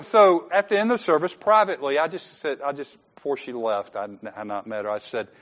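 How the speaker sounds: usual speaking voice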